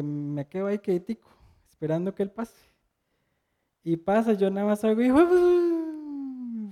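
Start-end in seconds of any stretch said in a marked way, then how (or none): 5.0-6.7 high, drawn-out furious yell; angry yell. Retold or imitated. Angry driver yelling from his car. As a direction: shout the insult as he speeds past. Driver yells insult passing by.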